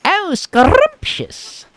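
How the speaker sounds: high, loud, drawn-out witch relish. Acted. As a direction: savor it slowly as a hammed-up witch, rolling the R. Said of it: "Anyone else hear that witch?".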